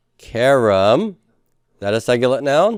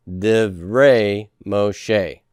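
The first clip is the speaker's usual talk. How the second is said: very slow, drawn-out "divrei Moshe"; slow pronunciation model; 2 semitones lower, much slower than usual. Shown as a demonstration. Teacher models Hebrew phrase for the class, slow pronunciation. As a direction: stretch each syllable of "divrei Moshe". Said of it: "Say that with me".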